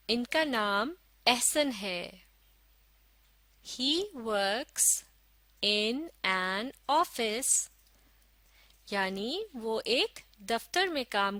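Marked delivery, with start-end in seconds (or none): 3.7-7.5 very slow, word by word; slow model reading. Shown as a demonstration. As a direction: read it out slowly for learners to follow. Model reading for learners to follow. (धीरे-धीरे, एक-एक शब्द पढ़कर)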